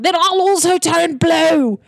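high, slow, loud call; shouting out. Acted. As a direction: shout it back with drawn-out words. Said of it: "Luke Hill shouts out".

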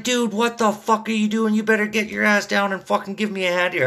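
imitating yourself losing it on the lazy courier; snapping at the courier. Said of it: "I just snapped".

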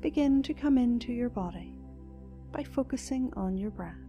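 soft, slow meditation voice; guided meditation. Acted. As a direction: guide them gently into calm.

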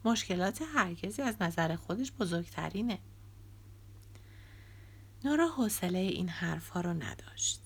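ordinary delivery, nothing marked

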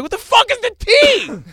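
high, loud exasperated outburst; retold blow-up, pitch shooting way up; frustrated. Boyfriend baffled by girlfriend's slang, frustrated shout. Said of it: "I'm, like, really frustrated".

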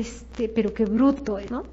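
slow, low, soft inner remark; muttered disbelief. Retold or imitated. Coach recalling private thoughts about a talkative recruiter, muttered disbelief. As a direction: say it under your breath, slowly. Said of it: "yo decía".

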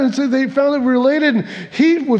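usual speaking voice

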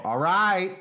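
loud, high call; mom's first warning. Acted. Imitating mom's first warning, loud high call. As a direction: holler it as a warning. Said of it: "First you would go".

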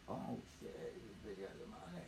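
hushed, voiceless aside; conspiratorial whisper. Retold or imitated. Two friends learning she has money, secret whisper. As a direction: whisper it so she won't overhear.

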